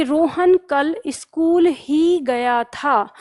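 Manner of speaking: slow, weight on school ही; slow emphasis on school ही; stress on school ही. Shown as a demonstration. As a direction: drag it out and lean on school. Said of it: "ही जो है वो किस पे जोर डाल रहा है".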